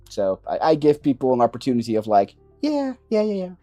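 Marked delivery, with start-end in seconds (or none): 2.6-3.6 high-pitched quick "oh yeah" of realization; acted-out reaction. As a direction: imitate someone catching on, pitched well up. Acting out people's dawning reaction.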